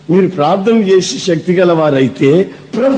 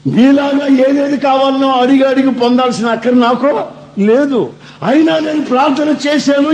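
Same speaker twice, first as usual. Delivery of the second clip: high, raised voice as Jesus teaching; 6 semitones higher than usual. Retold or imitated. Jesus telling believers about his prayer, raised high voice. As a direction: speak as Jesus addressing his followers, pitched up. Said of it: "ఆయన ఏం చెప్తాడు తెలుసా".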